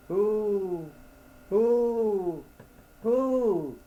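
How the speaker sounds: high, drawn-out owl hoot. A narrator's reading. Owl answering the lost man, high hooting.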